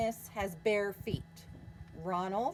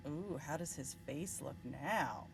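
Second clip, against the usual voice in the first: plain reading voice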